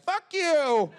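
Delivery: a heckler in the back yelling at the comic. Retold or imitated. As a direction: yell it from the back of the room. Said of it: "somebody in the back just like".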